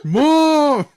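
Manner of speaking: very high loud yell; yelling across the room. Pretend. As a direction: yell for mom in anger. Son yelling.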